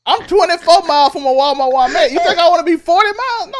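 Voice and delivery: loud, high-pitched protest; exasperated rant. Real, not acted. Exasperated rant about distance from Walmart.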